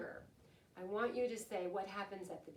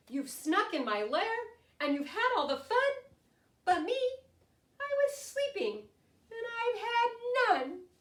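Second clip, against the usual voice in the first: higher, louder mock whimper of a grumpy bear; whiny complaint; 12 semitones higher, 8 dB louder than usual.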